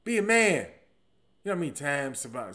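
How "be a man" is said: loud, raised, emphatic order; a higher, forceful call; tough-love command. Acted. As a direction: push someone to harden up. Acting generation's tough-love shout.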